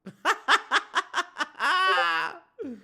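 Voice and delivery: loud high burst of laughter; big laugh. Real, not acted. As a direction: howl with laughter. Reacts to a funny picture.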